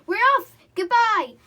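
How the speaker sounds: very loud high gloating; triumphant yell. A narrator's reading. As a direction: crow with triumph. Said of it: "a yell of triumph".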